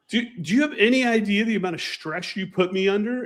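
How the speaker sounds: high, incredulous outburst; exasperated. Retold or imitated. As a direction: let the disbelief spill out. Exasperated retold reply.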